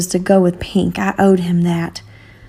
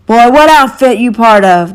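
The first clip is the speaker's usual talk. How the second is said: louder gruff demanding bark; an officer barking a question; 3 semitones higher, 7 dB louder than usual. A narrator's reading. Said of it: "their leader barked".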